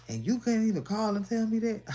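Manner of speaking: high, loud whiny complaint; mock-whiny retort from the other guy; mocking him. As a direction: imitate his petty complaint. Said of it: "That motherfucker said".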